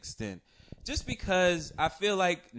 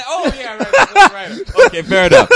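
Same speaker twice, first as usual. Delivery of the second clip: loud, high, laughing through words; laughing hard; 9 semitones higher, 17 dB louder than usual. Real, not acted. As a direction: crack up completely while agreeing. Cracking up at the fourth rider.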